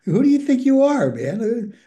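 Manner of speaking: high, loud mock indignation; mock indignant challenge. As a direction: act out a sponsor's incredulous put-down. Acting out sponsor's scornful challenge.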